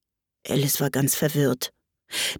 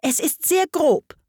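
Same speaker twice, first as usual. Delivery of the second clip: higher-pitched, severe reproach; prim, strict telling-off; stern; 9 semitones higher than usual. A narrator's reading. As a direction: scold with a certain strictness. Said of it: "mit einer gewissen Strenge".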